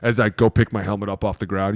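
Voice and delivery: ordinary delivery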